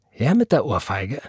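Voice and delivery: unruffled, daring reply; frightening composure. A narrator's reading. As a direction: call the bluff without shouting. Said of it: "mit ebenso beängstigender Gelassenheit". (beängstigend gelassen)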